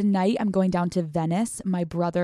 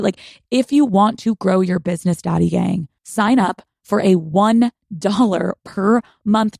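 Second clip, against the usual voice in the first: louder, upbeat sponsor read; 7 dB louder than usual. Acted. Host reading a Shopify ad to her listeners, louder and upbeat. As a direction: sell it to the listeners.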